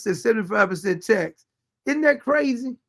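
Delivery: usual speaking voice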